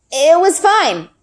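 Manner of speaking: slow, high, hesitant lie; a lie that barely comes out; forced fine. A narrator's reading. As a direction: push the words out slowly. Girl lies to her father.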